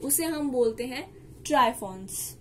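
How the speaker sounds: ordinary talk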